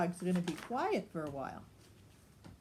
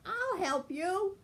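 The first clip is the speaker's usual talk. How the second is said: helpful fox cub; 9 semitones higher than usual. A narrator's reading. Fox cub offers help.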